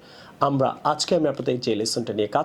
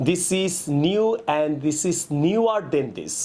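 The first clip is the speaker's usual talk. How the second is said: slow corrected example; much slower than usual. Shown as a demonstration. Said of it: "আপনি বলতে পারেন".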